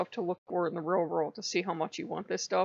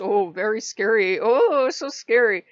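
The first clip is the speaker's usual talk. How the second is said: sarcastic mock fear; 3 semitones higher, 8 dB louder than usual. Mocking the weak defense.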